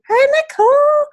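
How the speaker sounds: high singsong hello, held out; excited greeting. Real, not acted. Host greeting a friend joining the team call, excited singsong. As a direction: sing out a delighted hello, very high.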